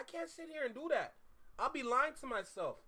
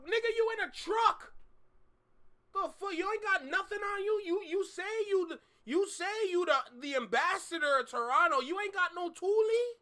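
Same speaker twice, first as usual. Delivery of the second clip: mocking disbelief; 6 semitones higher, 4 dB louder than usual. Real, not acted. Streamer mocking the rapper's threat.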